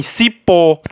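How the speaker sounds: drawn-out "cipó", weight on the final PÓ; last-syllable stress. Shown as a demonstration. Teacher giving students an oxytone example, stress on final PÓ of "cipó". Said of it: "com um acento na última sílaba".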